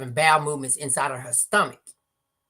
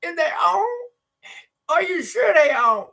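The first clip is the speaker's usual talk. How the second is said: put-on old father; 13 semitones higher, 5 dB louder than usual. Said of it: "He was like".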